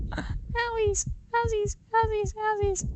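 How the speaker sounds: put-on high, babyish whine. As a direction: coo it like a hurt toddler. Tank hit, baby talk.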